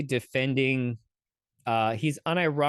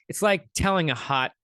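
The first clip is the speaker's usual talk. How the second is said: usual speaking voice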